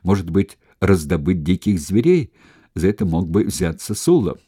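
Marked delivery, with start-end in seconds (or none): none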